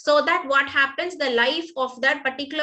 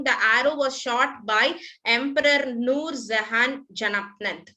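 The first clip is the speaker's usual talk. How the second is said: ordinary talk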